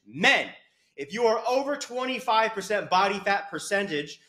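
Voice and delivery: worked-up tough-love lecture; exasperated rant. Real, not acted. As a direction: hammer it home, building to disbelief. Exasperated rant at overweight men.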